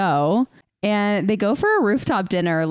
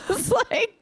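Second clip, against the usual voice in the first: laughing. Real, not acted. Laughing at the character's line.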